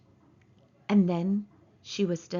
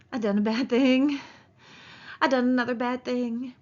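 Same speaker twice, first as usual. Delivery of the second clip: high, voiced childlike dread; mild fright, each word a dismayed confession; frightened; 4 semitones higher, 4 dB louder than usual. A narrator's reading. Man realising she is dead, frightened. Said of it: "he whispered in fright".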